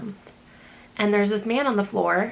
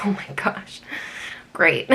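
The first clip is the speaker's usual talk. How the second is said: sarcastic whisper; 2 semitones lower than usual. Retold or imitated.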